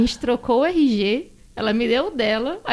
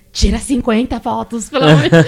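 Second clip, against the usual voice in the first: frantic plea; 7 dB louder than usual. Retold or imitated. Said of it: "Aí eu fiquei ali pro cara".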